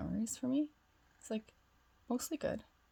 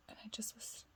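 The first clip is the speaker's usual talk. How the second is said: hushed quote; 3 semitones higher, 13 dB quieter than usual. Retold or imitated. Quoting the emotional older man.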